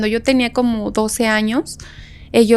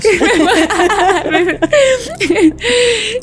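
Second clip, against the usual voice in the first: laughing; 9 semitones higher than usual. Real, not acted. Laughs hard at the story.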